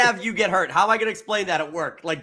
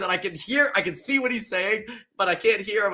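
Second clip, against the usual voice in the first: ordinary talk, nothing marked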